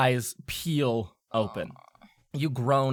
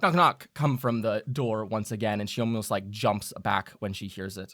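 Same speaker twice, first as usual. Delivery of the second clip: usual speaking voice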